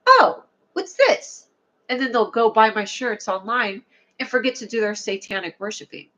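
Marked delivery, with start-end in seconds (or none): 0.1-1.5 high, slow, wide-eyed "oh, what's this"; curious surprise. Pretend. People who stumble on the cards, drawn-out surprised wonder. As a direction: play a stranger delighted by a find. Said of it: "and they'll be like".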